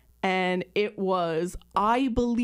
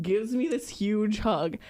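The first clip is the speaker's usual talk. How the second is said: ordinary talk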